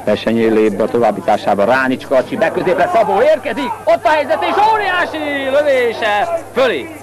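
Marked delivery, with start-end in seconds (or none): 1.6-6.9 excited shout. Real, not acted. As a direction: build to a shout on the shot. Chance goes over the bar.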